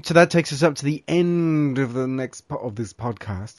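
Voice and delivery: tripping over the words mid-sentence; a fumbled sign-off with a restart on "po-"; stumbled take. Real, not acted. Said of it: "Let me try that again".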